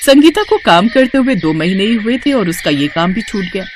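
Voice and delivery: plain reading voice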